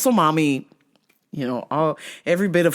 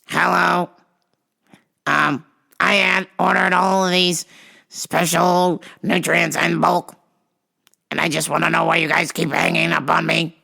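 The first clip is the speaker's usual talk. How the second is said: muscle mommy on phone. Retold or imitated. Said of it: "She calls back a third time".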